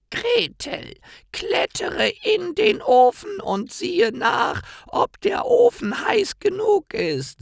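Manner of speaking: very high, slow, loud shouting crone; commanding witch. A narrator's reading. Witch orders Gretel into the oven, high and shouted. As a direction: bark the order with false urgency.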